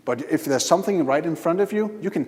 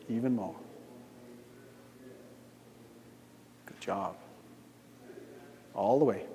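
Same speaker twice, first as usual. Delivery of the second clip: hypnotic guidance; 3 semitones lower than usual. Acted. Guides trance acceleration.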